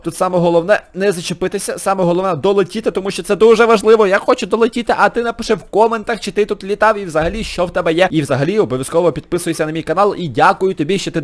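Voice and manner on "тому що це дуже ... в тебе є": ordinary talk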